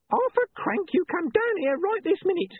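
very high, screechy nagging wife; high-pitched henpecking holler; scolding wife. A narrator's reading. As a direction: call your husband down in a piercing high voice. Wife shouts up at husband.

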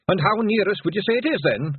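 chatty Scottish pixie voice; conversational Scots pixie. A narrator's reading. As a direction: ask it lightly in a high Scots voice. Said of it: "asked Rob conversationally".

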